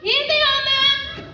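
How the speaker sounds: urgent announcement. Acted. Servant presents keys in panic.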